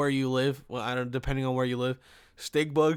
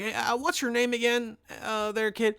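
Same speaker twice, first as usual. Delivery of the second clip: put-on pitched up, drawled old-customer accent; mock the customer's funny way of talking; imitating his accent; 8 semitones higher than usual. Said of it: "this guy's accent was so fucking funny".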